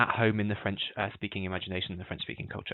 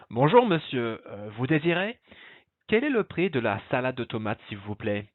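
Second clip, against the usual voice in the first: hammed-up French accent; 3 semitones higher, 3 dB louder than usual. Shown as a demonstration. Teacher modelling a dialogue for the class, hammed-up French accent. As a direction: ham up the French, relishing the melody. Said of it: "really hamming up my French accent".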